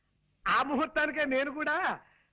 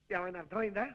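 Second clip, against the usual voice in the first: ordinary talk, nothing marked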